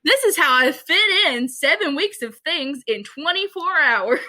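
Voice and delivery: light impression of a perky YouTube mom. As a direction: sell it like a cheery mom vlogger. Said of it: "and she's like".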